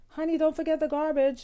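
high, sweet reminder; said nicely. Shown as a demonstration. Wife sweetly reminding husband about garbage. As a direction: coax him gently. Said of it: "Just say it nicely".

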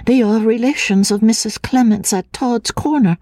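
put-on high, hesitant, frightened girl's voice; a nervous fragile woman, stammering; lightly breathy, fearful young woman; timid young woman. A narrator's reading. Anne's voice in dialogue.

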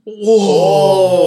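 long, loud, high "whoa"; shocked reaction. Real, not acted. Cohost hearing a friend bullied a star, shocked reaction. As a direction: cry out in drawn-out shock.

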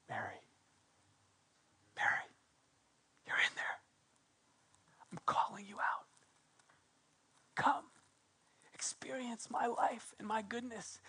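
a soft whisper, calling her by name; Jesus whispering to Mary; tender whisper. Acted. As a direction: lean in and whisper the invitation.

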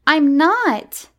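high, loud sulky denial; sulky child. A narrator's reading. Miranda denies telling stories, sulky and high. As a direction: deny it like a sulking child. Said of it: "said Miranda sulkily".

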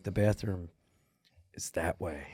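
very soft, low, halting delivery; underplay it to a near whisper, broken by a pause; toned-down whisper. Retold or imitated. Actor told to take it down a little, overly hushed. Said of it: "take it down a little less".

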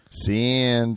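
lightly voiced cartoon mouse; a hint of a lazy cartoon mouse; Slowpoke Rodriguez. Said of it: "the impression like Slowpoke Rodriguez".

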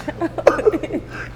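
muffled unvoiced laugh; stifled breathy giggle. Real, not acted. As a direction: giggle silently into the mic. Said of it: "I'm trying not to laugh so hard".

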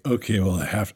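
deep slurred hungover drone; low hungover voice. Retold or imitated. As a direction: drop low like a hangover. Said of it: "and it's like".

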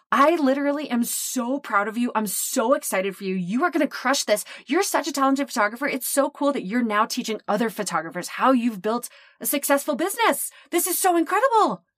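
high, gushing praise rising to a peak. Retold or imitated. Client gushing praise at her, excited and high. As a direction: pile on the enthusiasm.

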